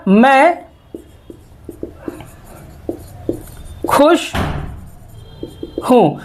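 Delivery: each word set apart by long pauses. Shown as a demonstration. Teacher breaking मैं खुश हूँ into separate words. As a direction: say it one word at a time so students follow. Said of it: "teacher spells out the sentence".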